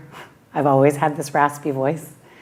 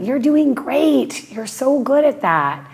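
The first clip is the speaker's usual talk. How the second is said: much higher, mock-gushing praise; cheering big brothers; 11 semitones higher, 3 dB louder than usual. Brothers cheering their little sister on, high and gushing. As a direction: hype her up like a proud brother. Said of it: "They were always telling".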